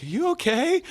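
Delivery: very high, timid-sounding voice; concerned assistant. Retold or imitated. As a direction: imitate a worried assistant in a high voice. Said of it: "And she's like".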